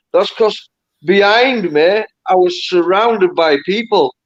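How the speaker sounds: ordinary delivery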